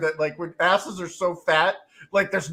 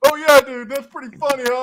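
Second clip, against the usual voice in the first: smug teasing; 6 semitones higher, 7 dB louder than usual. Retold or imitated. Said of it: "Like, the, like".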